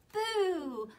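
high, playful boo; mock scare for toddlers; playful peekaboo. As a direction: pop out at little children with a boo. Peekaboo in hiding song.

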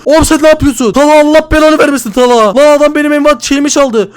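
real gamer rage at a friend; furious shout. Real, not acted. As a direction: roar threats at the thief Talha. Furious at Talha stealing.

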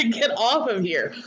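usual speaking voice

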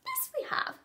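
put-on very high bright little boy; helpful child. A narrator's reading. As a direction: chirp it eagerly like a small child. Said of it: "said Patrick, helpfully".

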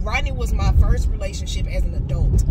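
usual speaking voice

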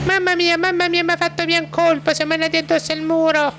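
high falsetto frightened wife; squeaky high woman after crash; Gustava voice. Acted. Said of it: "la Gustava".

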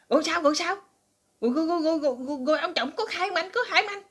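high, fast and anxious; wife anxiously pressing her husband for news, high and rushed; frantic high questions. Retold or imitated. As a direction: rattle it off in a panic. Frantic wife asks what happened.

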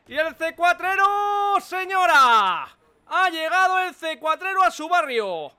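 loud, high market hawker; street vendor. Pretend. Salesman pitch again.

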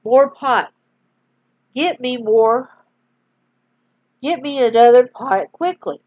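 mother urgently crying out for pots. Acted. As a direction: hurry the boys along. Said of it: "she cried".

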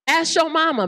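ordinary delivery

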